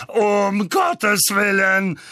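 slow, high, loud roar; Onkel Vernon, roaring. A narrator's reading. Uncle sees another owl arrive, roaring. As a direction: bellow each word in drawn-out despair. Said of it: "rührte Onkel Vernon".

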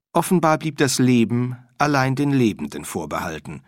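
ordinary delivery

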